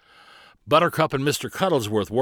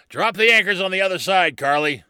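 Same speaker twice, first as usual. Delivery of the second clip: higher-pitched urgent command; called-out order; 9 semitones higher, 4 dB louder than usual. Acted. Barnabas orders anchors dropped in the riptide, calling urgently. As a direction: call the order firmly to the captain.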